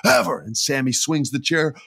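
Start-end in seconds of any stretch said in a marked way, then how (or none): 0.0-0.4 high, hammed-up threat; mock furious warning, higher pitch; imitating angry threat. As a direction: snarl it like a furious wrestler. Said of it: "still cussing out Kevin Owens".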